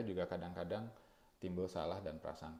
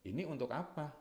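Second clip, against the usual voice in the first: higher, pointed demand that questions the motive; challenging question; 7 semitones higher, faster than usual. Shown as a demonstration. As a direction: throw "Ini untuk apa?" up high as a challenge. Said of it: "Intonasi sudah akan membedakan". (nada tinggi yang mempertanyakan, menantang)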